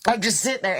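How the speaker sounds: ordinary delivery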